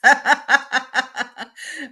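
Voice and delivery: a bright burst of real laughter; amused laugh. Real, not acted. Medium laughing at the spirit's joke to the sitter, warm chuckle.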